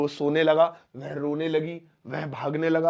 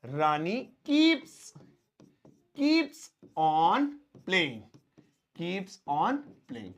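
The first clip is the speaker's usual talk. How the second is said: slow dictation; much slower than usual. Shown as a demonstration. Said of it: "तो कैसे लिखेंगे".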